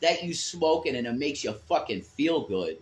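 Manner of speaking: ordinary talk, nothing marked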